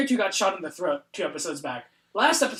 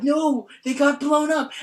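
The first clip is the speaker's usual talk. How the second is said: dismayed cry; 7 semitones higher, 5 dB louder, slower than usual. Retold or imitated. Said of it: "all the characters are like".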